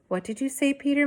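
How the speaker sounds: mother's voice. A narrator's reading. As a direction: lift into the mother's voice.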